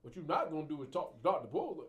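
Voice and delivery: loud, high mock snap at the patient. Shown as a demonstration. Therapist demonstrates what not to do, loud and high. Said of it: "So I'm not gonna raise my voice".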